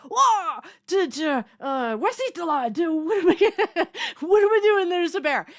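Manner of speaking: high, loud flustered yelp breaking into laughs; mock panic. Speaker acting out seeing a bear on the porch, in mock panic. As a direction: play a hammed-up freak-out at a bear.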